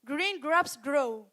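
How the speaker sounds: slow, deliberate model; teacher modeling a tongue twister slowly. Shown as a demonstration. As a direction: spell it out slowly for the class. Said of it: "you have to say".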